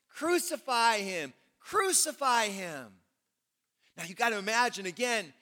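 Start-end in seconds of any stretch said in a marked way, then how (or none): 0.1-3.0 mock crowd shout. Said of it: "they kept shouting".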